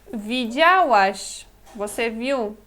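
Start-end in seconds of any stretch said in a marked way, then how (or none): 0.1-1.5 Widziałaś drawn out slowly; slow model word. Shown as a demonstration. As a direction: say Widziałaś at half speed. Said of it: "Então, de novo".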